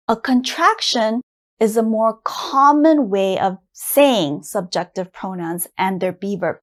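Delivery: normal talking voice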